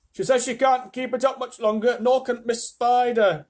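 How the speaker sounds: high, loud relayed warning; yelled. A narrator's reading. Centipede relaying the spinners' complaint, loud yell. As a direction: keep yelling up from below, dragging the end. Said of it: "yelled the centipede from below".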